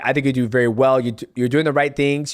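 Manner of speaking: normal talking voice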